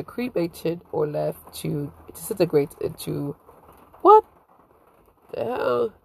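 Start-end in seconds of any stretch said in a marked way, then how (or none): 4.0-5.9 surprised. Real, not acted. Startled by bird outside.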